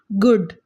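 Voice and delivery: good with a brief vowel; good, vowel cut short for contrast. Shown as a demonstration. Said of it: "ऊ का sound difference आ रहा है".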